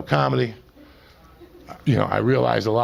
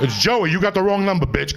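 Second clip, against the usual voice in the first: mock irate phone brush-off; 7 semitones higher than usual. Comic telling a caller asking for Jose off, mock irate.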